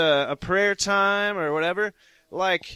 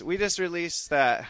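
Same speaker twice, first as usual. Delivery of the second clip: normal talking voice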